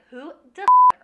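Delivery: high, loud cut-off curse; startled outburst. Retold or imitated. Gustav startled by the lobby boy, loud curse. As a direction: blurt it out, then catch yourself. Ray Fiennes startled by the kid.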